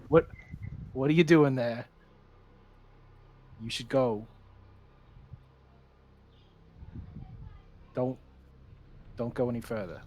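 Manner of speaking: familiar voice. Acted. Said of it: "I can't do Boston accent".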